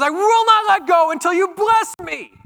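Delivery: high, loud, straining cry; desperate shout. Acted. Jacob shouting at God while wrestling, desperate shout. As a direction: Jacob yells, refusing to let go. Jacob clinging, demanding blessing.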